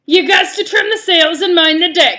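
loud, high pirate captain's growl; impatient pirate. Acted. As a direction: lay down the law as a fed-up pirate. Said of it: "He had finally lost his patience".

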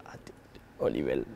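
hushed, breathy aside; retold whisper. Whispering to his mate about twelve and a half kilos extra. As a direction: whisper it like a private joke.

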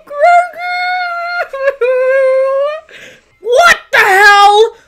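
upset cry, loud; shocked shout. Real, not acted. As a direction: shout in disbelief. Shocked outcry.

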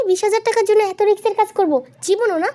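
high-pitched indignant protest; indignant disbelief. Acted. Friend refusing a risky job, indignant.

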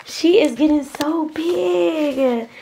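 high, slow proud coo. Real, not acted. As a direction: coo over how big she is. Mom cooing about baby.

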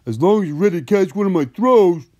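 high, loud, jolly giant voice; happy giant. A narrator's reading. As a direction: call it out big and happily. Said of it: "said the giant happily".

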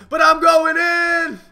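slow, high, loud battle yell; battle cry. Real, not acted. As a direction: bellow it as you charge in. Charging into a fight.

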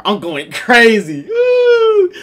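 slow, loud, cracking hype yell; hyped yell. Real, not acted. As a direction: drag it out and scream with joy. Hyped about parallel parking.

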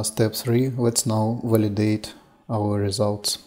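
usual speaking voice

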